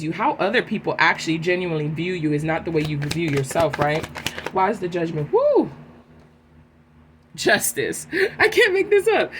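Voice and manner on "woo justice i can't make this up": surprised. Real, not acted. Card pull surprise.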